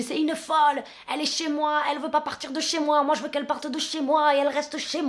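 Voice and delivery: tossing the words out, fed up with her; neighbour's quote. Retold or imitated.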